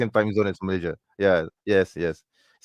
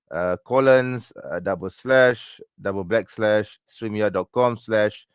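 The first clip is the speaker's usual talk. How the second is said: slow dictation; slower than usual. Real, not acted. Dictating a link on screen.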